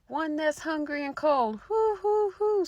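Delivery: high, eerie owl-like moan; spooky hooting voice. Acted. Stranger at the door begging the witches, high hooting wail. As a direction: plead from outside, then hoo like an owl. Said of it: "said a voice".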